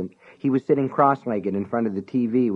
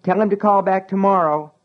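yelled; 10 semitones higher, 4 dB louder than usual. Acted. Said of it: "he yelled".

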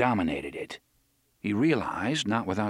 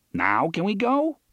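impatient; 9 semitones higher, 3 dB louder than usual. Acted. Eddie impatient.

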